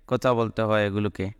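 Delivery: usual speaking voice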